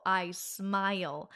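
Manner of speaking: ordinary talk, nothing marked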